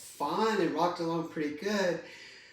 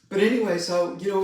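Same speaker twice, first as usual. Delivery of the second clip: still teary; 3 semitones higher, 8 dB louder, faster than usual. Real, not acted. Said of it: "I'm being so emotional".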